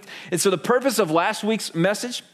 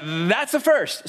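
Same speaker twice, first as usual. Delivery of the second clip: lightly voiced amused big man, slow; a hint of a deadpan football player; big friend answers the lady with a laugh; dry big guy; 4 semitones higher than usual. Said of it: "He goes".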